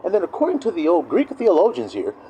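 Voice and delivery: mock a seminary know-it-all; theologian. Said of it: "they start going".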